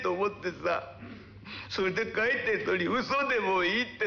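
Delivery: hammed-up whiny sulk, slow at the end; fake crying. Boy fake-crying at his uncle over the drawing, mock tearful. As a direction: put on a tearful boy's reproach. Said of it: "また嘘泣きか".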